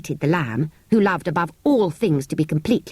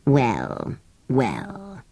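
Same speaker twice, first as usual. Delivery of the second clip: indulgently; 6 semitones lower than usual. A narrator's reading. Sand fairy giving in to Anthea, indulgent. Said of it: "it said indulgently".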